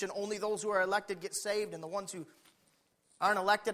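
ordinary talk

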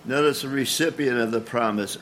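usual speaking voice

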